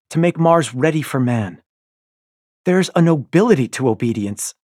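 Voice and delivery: loud, high, firm declaration; proud defiance. A narrator's reading. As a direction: proclaim it with stubborn pride. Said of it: "I snap".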